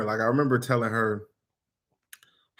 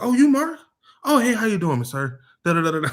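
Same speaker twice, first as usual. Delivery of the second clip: mock sweet, high and louder; sudden politeness; 9 semitones higher, 3 dB louder than usual. Customer meets the big salesman, mock fake-polite greeting. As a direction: play the tough customer suddenly all nice.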